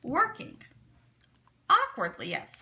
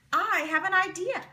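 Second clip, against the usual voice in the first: excited announcement; 7 semitones higher, 5 dB louder than usual. A narrator's reading. Sam excited after crying out.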